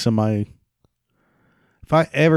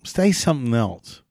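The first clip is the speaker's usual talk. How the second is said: exasperated plea; 4 semitones higher, 4 dB quieter than usual. Retold or imitated. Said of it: "I'm just like".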